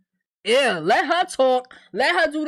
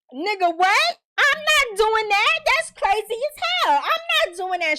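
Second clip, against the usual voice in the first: much higher, louder, slowed protest; imitated indignant refusal; 10 semitones higher, 4 dB louder than usual. Bakari refusing Tiffany's order, high and loud. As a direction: refuse like it's the wildest ask ever.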